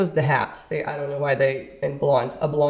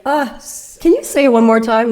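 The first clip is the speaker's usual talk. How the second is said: high, loud puzzled request; baffled plea; 7 semitones higher, 7 dB louder than usual. Retold or imitated. Asking the Cajun hunter to repeat, baffled. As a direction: plead for clarity, playing up confusion. Acted confusion at unintelligible word.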